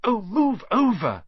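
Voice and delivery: loud, high snarl. A narrator's reading. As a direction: snap it impatiently. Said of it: "Hermione snarled".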